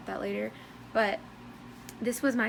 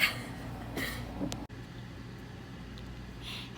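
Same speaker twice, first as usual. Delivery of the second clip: giggly laugh; 8 dB quieter than usual. Real, not acted. Laughs after hype question.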